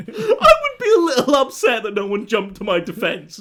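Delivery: high, loud laughing disbelief; laughing outrage. Real, not acted. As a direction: squeal it through real laughter. Exasperated laughing rant.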